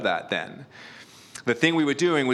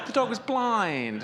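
higher, slower, breaking into a laugh; sympathetic correction; 11 semitones higher, slower than usual. Real, not acted. Pastor correcting himself to the congregation, laughing sympathetically. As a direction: take it back and say it kindly through a laugh. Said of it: "Sorry, I".

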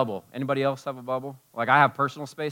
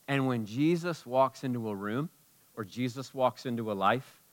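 ordinary talk

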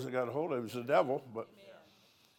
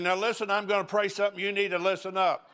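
raised, higher call to the whole cabin; half-hearted shout for everyone's attention; mock yell; 6 semitones higher, 4 dB louder than usual. Pastor mock-announcing to the plane, a raised call. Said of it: "I didn't yell at everybody".

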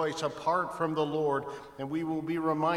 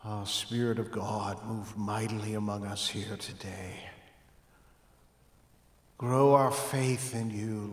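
hushed, reverent opening prayer; 5 semitones lower than usual. Real, not acted. Pastor praying over the church, hushed and reverent.

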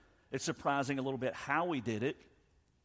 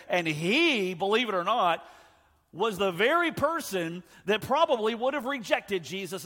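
"he" pushed high and very loud, force carried on; raised, forceful preacher's emphasis from "he" onward; emphatic HE; 9 semitones higher, 10 dB louder than usual. Shown as a demonstration. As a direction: hit "he" like bold, underlined all caps. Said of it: "but he's emphasizing".

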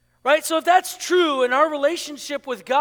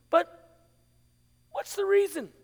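high, careful asking after a pause; tentative clarifying question; 7 semitones higher than usual. Acted. Pastor asking someone why they want to meet, high and tentative. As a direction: lift the pitch and ask delicately.

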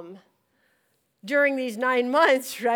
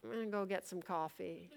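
low, very soft deadpan; unfazed husband; 4 semitones lower, 15 dB quieter than usual. Retold or imitated. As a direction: imitate a husband quietly excusing himself. Husband's quiet escape from meltdown.

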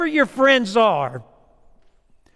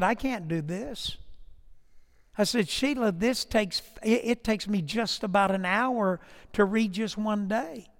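weary retold complaint; 8 dB quieter than usual. Retelling complaint to wife Sheila.

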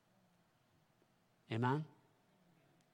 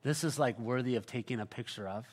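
ordinary delivery

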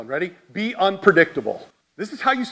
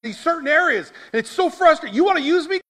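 much higher, exasperated pleading with God; pleading argument; 11 semitones higher than usual. Retold or imitated. Preacher retells arguing with God, high and pleading. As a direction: push back at God, protesting. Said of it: "This was my argument".

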